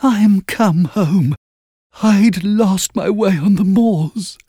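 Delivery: high, slow ghost voice, trembling with cold; a frozen child ghost naming herself; shivering ghost voice. A narrator's reading. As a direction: ghost child shivering as she gives her name. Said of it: "shivering with cold".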